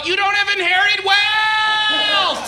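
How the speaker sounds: slow, high mock yell down a water slide; a hammed-up bitter yell. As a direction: drag out a pretend yell. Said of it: "just yell".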